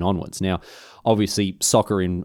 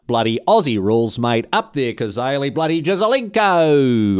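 hammed-up broad Australian footy fan; ocker Aussie fan; 5 semitones higher, 4 dB louder than usual. Australian fan mocks rival code with broad Australian cheer. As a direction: cheer like a bogan Aussie supporter.

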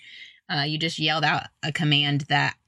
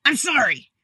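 higher, snippy "I'm sorry" thrown back at a sibling; imitating a kid's bratty higher "I'm sorry"; mocking kid apology; 7 semitones higher than usual. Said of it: "they're looking at each other like".

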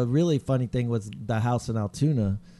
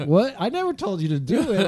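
higher, louder disbelief; shocked; 8 semitones higher, 4 dB louder than usual. Retold or imitated. Homeowner shocked the roofer started without approval, outraged surprise.

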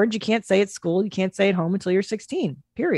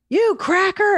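put-on kid insult; 12 semitones higher, 3 dB louder, slower than usual. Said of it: "And he's like".

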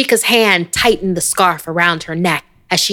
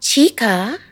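sweet voice; 8 semitones higher than usual. A narrator's reading. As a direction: sweetly lure her in. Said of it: "A sweet voice called".

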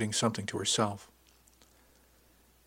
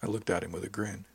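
ordinary delivery, nothing marked

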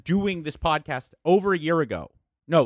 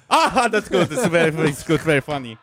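louder hammed-up German accent; mock German accent, louder; 5 dB louder than usual. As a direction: imitate a German boss laughing off a joke. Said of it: "he had a very heavy accent".

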